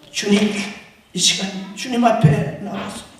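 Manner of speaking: ordinary delivery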